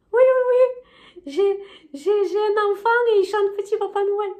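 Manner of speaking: high, bright-eyed shop cashier. Retold or imitated. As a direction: play the chirpy cashier up high. Cashier voiced in retold story. (voix de caissière aiguë)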